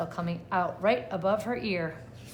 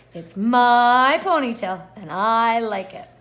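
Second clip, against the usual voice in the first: loud, slow and proud, stressing ponytail; loud defiant retort; 9 dB louder, slower than usual. Acted. Stephanie answering the kids, loud slow defiance. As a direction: stand your ground, slow and firm.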